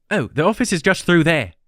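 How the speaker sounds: put-on young woman, light English accent; bright English girl's voice. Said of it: "She had an English accent".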